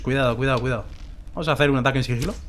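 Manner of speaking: usual speaking voice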